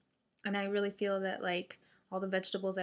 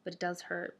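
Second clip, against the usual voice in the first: ordinary delivery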